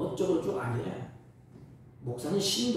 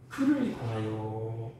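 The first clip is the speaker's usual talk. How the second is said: normal talking voice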